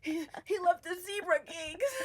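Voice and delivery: high, loud, tearful wail, voice cracking; retold crying. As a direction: sob it out like you did in the car. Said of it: "I cried".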